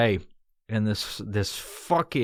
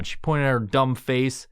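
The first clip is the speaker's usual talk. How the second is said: ordinary talk, nothing marked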